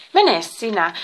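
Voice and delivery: loud, cross command; snappish. A narrator's reading. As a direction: send him off irritably. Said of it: "kehoitti Pandora äkäisesti". (äkäinen kehotus)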